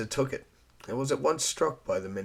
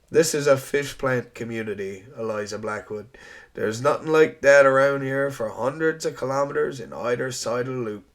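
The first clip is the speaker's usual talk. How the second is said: louder, cheerful Rogers; amused island man; 4 semitones higher, 7 dB louder than usual. Acted. Rogers offering to show Eliza the house, amused. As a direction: reassure her with a smile.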